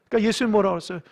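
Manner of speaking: ordinary delivery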